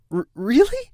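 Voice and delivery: light up with joy; excited disbelief. Acted. Colt to his brother, high excited stammer. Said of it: "wing buzzed happily".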